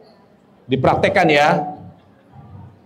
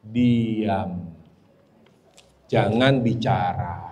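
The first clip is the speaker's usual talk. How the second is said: low soft instruction; 11 semitones lower, 6 dB quieter, much slower than usual. Shown as a demonstration. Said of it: "apa lagi kalau lagi marah".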